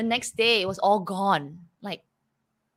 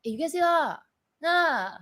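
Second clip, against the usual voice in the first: higher Singaporean lilt on "ah" and "nah"; 7 semitones higher, slower than usual. Retold or imitated. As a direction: ask casually, Singaporean style. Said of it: "they say".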